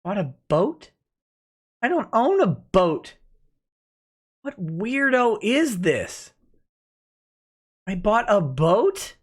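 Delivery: incredulous, pitch swinging up on the question; stunned, drawn-out disbelief; baffled disbelief. Real, not acted. Streamer reacting to a rumor he bought a boat, baffled.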